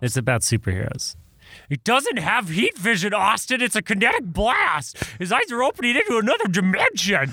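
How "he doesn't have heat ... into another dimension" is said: put-on whiny nerdy kid. Nerdy son correcting dad.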